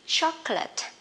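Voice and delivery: chocolate said as a teacher's model, clear and deliberate. Shown as a demonstration. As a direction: say chocolate slowly and clearly as the target word. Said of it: "Từ đầu tiên nè".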